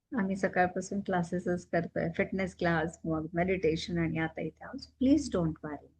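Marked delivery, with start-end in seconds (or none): none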